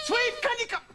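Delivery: high, sharp call, cut short. Acted. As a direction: start yelling for the dog. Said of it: "wrong to raise my voice".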